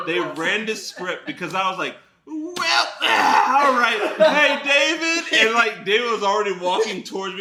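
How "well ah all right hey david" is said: high-pitched, stretched-out stalling; high awkward exit. Retold or imitated. Man bails and calls David over, high and awkward. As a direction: stall high, then call for help. Re-enacting his panicked exit.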